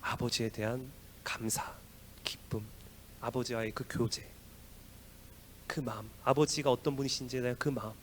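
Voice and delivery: preacher lowers to a whisper to the church; hushed whisper. Real, not acted. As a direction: drop to a hushed whisper for weight. Sermon drops to hush.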